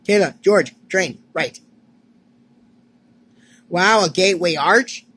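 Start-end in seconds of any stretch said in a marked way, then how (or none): none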